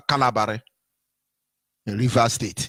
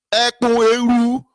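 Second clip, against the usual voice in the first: loud slow proclamation; 6 semitones higher, 6 dB louder, slower than usual. Real, not acted.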